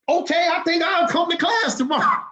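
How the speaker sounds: high, loud eager promise; eager shout. Retold or imitated. Recruit shouting his promise to the captain, high eager shout. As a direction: blurt it out, suddenly converted.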